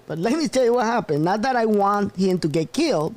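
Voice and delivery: normal talking voice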